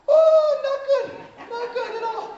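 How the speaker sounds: very high, loud panicked squeal; traumatized boy. Acted. Son telling mom about the trip, high traumatized squeal. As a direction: squeal it in hammed-up distress.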